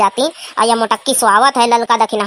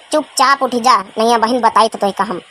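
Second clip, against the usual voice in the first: ordinary talk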